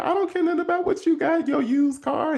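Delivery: teasing, high and playful; joking tease. Retold or imitated. Boyfriend teasing his anxious girlfriend, lightly joking. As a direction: joke to lighten her worry about her used car. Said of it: "He was joking on her".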